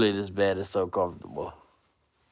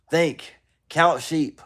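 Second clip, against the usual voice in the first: energetic urging; 8 semitones higher, 6 dB louder, much slower than usual. Real, not acted. Rousing self.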